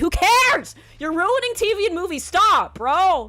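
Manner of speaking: loud, high, exasperated shouting; furious rant. Real, not acted. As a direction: yell at the whole industry, fed up. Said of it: "I'm getting, like, so mad".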